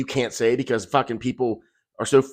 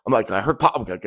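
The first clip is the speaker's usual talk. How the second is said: normal talking voice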